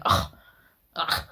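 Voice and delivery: mimicking a dismissive "Ugh, ugh"; mock dismissive groan. Reactor mimicking the partner's dismissive groans. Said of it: "they're going".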